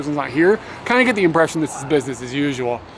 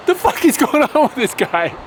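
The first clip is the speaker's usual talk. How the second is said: exasperated; 7 semitones higher than usual. Real, not acted. Exasperated at rude chef.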